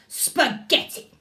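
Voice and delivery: whispered, slowed grumpy spaghetti; a huffy, breathy grumpy word. Shown as a demonstration. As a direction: grumble it through your teeth. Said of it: "sounds grumpy".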